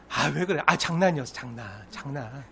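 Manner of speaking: soft, low, mumbled-away mock excuse; dismissive excuse. Offender telling hurt neighbour it was joking, dismissive. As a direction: brush it off as nothing, fading.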